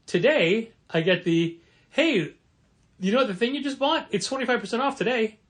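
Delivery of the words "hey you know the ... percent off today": chirpy sales voice. Retold or imitated. Mocking sale email voice.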